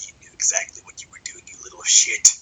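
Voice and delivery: whispered mock accusation from the teachers. Teachers accusing him, retold as whispered mockery. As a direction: hiss it under your breath, imitating them. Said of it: "they're like".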